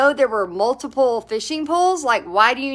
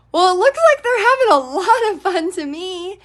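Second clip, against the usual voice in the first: higher, laughing, breezy comeback; amused reply; 6 semitones higher than usual. Retold or imitated. Retelling her amused reply to the grumpy man, higher and laughing. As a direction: answer through a laugh, unbothered. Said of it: "I just started laughing and I said".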